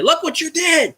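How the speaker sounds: high, put-on voice scolding an old friend; squeaky pretend telling-off of the quarterback who left; mock scolding. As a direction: wag a finger at him in a high mock voice. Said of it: "And I'm gonna say".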